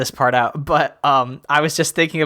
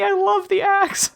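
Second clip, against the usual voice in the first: ordinary talk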